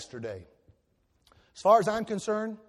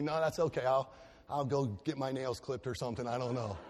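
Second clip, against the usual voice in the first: dropping lower and softer, backing away; evasive reply; 5 semitones lower, 5 dB quieter than usual. Retold or imitated. Husband dodging wife's Hallmark movie, fading mumble. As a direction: mumble an excuse and fade. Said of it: "husband declining the movie".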